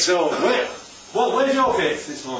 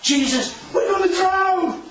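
panicked cry; 12 semitones higher than usual. Retold or imitated. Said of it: "Plant that thought into this situation".

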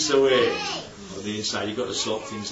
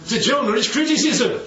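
ordinary delivery

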